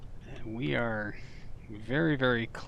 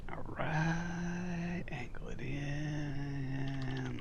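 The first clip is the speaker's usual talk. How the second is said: whispering; 4 semitones higher, 5 dB quieter, much slower than usual. Real, not acted. Careful landing, whispered guidance.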